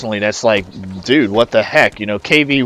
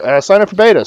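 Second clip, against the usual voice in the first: mock announcement; 10 semitones higher, 6 dB louder, faster than usual. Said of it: "And they're like".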